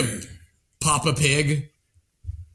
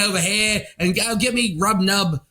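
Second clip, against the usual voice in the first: put-on Australian accent. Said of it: "a lot more pleasant to hear that accent".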